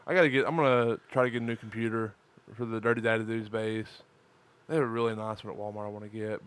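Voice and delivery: ordinary talk, nothing marked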